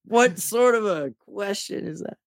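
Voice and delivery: high-pitched, mock-offended question; mock indignation. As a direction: act playfully scandalized.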